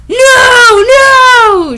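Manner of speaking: high, loud crying shout of "no"; shrieking no. Acted. Denying being a prep, a high hammed-up shriek. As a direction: shriek it in mock panic. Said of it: "she laughed".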